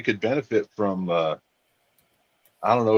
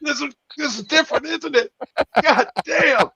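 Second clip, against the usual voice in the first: strained high voice. Acted. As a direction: squeak it out like the spice is burning. Acting pained over spicy food.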